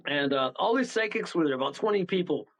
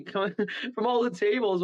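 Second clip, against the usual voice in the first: normal talking voice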